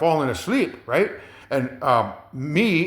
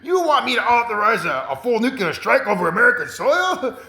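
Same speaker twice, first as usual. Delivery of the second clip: much higher, disbelieving question; incredulous president; 7 semitones higher than usual. Retold or imitated. President questioning his adviser's nuke plan, in high disbelief. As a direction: demand it back like you can't believe your ears. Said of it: "the president asked him".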